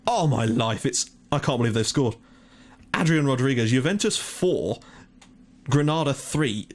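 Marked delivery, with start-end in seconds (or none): none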